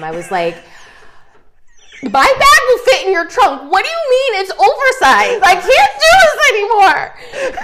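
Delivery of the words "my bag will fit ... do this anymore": high, loud tearful wail at the driver; crying shout. Retold or imitated. Daughter wailing at the cab driver, loud and high, half crying. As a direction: sob it out high and loud, at the end of her rope. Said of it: "I literally started crying at him".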